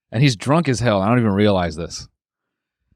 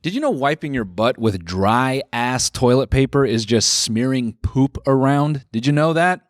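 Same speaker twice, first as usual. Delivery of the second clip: higher-pitched, upbeat podcast ad voice; sponsor ad read; 4 semitones higher than usual. Acted. Host thanking the sponsor in an ad-read voice. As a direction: sell it to the listeners like a host read.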